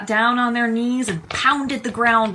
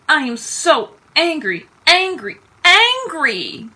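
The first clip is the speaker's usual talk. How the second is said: louder with each angry; stamping fury building word by word; angry outburst; 7 semitones higher, 7 dB louder, slower than usual. A narrator's reading. On alone in his room, building angry cry.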